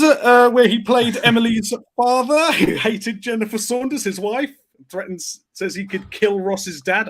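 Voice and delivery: ordinary talk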